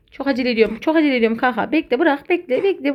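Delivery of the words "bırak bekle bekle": usual speaking voice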